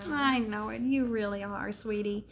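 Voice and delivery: soft, affectionate murmur; tender reassurance. Real, not acted. Answering her sweetie's reaction, tenderly reassuring. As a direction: reassure a little one quietly.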